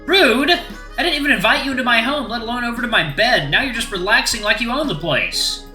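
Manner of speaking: high-pitched, affronted outburst; indignant scolding. Acted. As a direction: tell off an uninvited guest. Scolding the uninvited guest.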